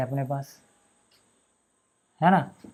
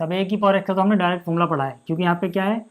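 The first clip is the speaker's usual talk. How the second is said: usual speaking voice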